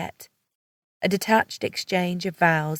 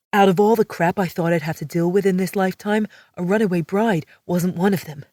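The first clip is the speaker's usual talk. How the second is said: exasperated man catching a runaway; male character voice; 4 dB louder than usual. A narrator's reading. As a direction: read as the irritated male lead. Riggs voiced in story.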